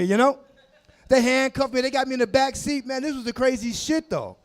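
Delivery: normal talking voice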